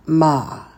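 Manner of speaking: "Ma" with a long ah, falling; teacher's careful "Ma" for repeating; pronunciation demo. Shown as a demonstration. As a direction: model "Ma" as one clean syllable. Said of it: "And that is ma".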